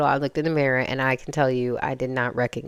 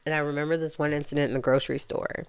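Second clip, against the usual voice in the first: normal talking voice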